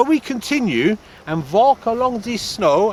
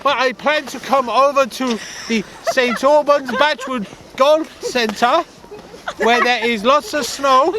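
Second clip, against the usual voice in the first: lightly put-on Norwegian accent; a hint of a Norwegian accent; mock Norwegian accent; 3 semitones higher than usual. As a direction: play a bumbling Norwegian skier. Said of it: "like a Norwegian Frank Spencer".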